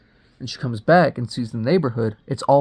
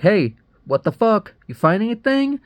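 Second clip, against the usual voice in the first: raised, higher calling voice; 9 semitones higher, 5 dB louder than usual. Retold or imitated. She calls to the man down in the basement, calling out. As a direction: call down the stairs, pitch climbing.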